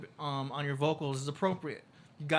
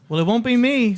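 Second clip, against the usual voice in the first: much higher and louder, real refusal; 7 semitones higher, 14 dB louder than usual. Real, not acted. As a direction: blurt it out, no way I'm doing that. Refusing to hold the spider.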